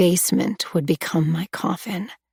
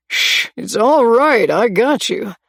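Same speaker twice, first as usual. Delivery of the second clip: soothing reassurance; 4 semitones higher, 4 dB louder than usual. A narrator's reading. Stranger comforting terrified girl.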